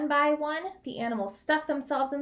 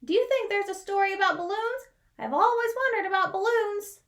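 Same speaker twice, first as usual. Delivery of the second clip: higher, louder wondering voice; dreamy Porcupine; 7 semitones higher, 4 dB louder than usual. Acted.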